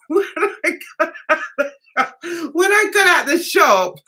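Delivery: words through helpless laughter; cracking up mid sentence; laughing hard. Real, not acted. Telling the shop story, laughing hard.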